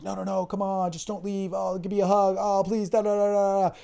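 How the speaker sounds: mock whiny begging. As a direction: mimic a needy guy begging her to stay. Mocking the friend begging the girl.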